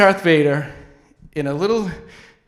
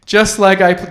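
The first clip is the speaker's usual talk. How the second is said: normal talking voice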